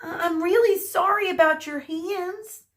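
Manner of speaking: high, stammered apology ending on a rise; apologetic Medusa. A narrator's reading. Medusa apologises to the injured hairdresser, high and hesitant.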